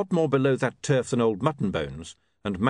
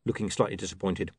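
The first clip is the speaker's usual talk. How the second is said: plain reading voice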